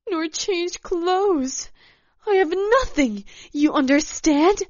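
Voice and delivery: high, loud, distraught tirade; heated, high-pitched complaint; angry protest. A narrator's reading. Acted quarrel, furious woman protesting.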